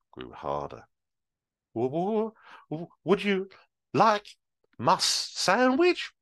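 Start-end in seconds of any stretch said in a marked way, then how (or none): 1.7-6.1 high, stuttering frightened granny; stammering old lady. Acted. Old lady nervously offering Superman her sandwich, stammering. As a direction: stammer it out scared. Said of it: "she finally said".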